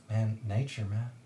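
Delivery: soft, low aside; whispering. Acted. Glint whispers to the grumbling companion, hushed and low. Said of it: "Glint whispers".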